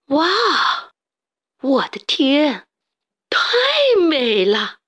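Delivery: awed cry of delight; amazed gasp. Acted. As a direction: gasp at something dazzling. Pero dazzled by the green light.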